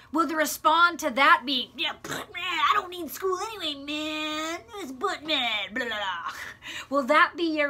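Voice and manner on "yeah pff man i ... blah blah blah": high, careless slacker voice; dismissive teen. Acted. Preacher mocking a student who shrugs off school, careless slacker voice. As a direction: brush off the report card like a bored teen.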